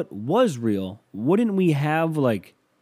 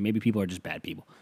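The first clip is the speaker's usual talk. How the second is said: ordinary talk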